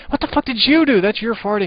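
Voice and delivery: very high, loud shrieking accusation; wife screaming. Retold or imitated. Wife screaming at husband over the fart smell. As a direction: shriek the blame at him. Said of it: "my wife screams".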